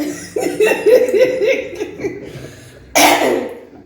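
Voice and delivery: high, loud burst of laughter. Real, not acted. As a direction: laugh out hard. Laughs at the partner.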